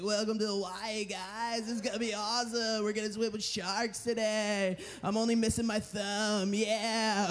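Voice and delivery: a lightly voiced chill surfer welcoming tourists; surf dude. Tour guide greeting the boat passengers in a mellow surfer voice. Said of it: "he's, like, a surf dude".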